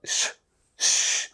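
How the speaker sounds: quiet shushing श; श breathed out with the tongue up; sh sound. Shown as a demonstration. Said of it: "ऊपर की तरफ जीभ हो गई".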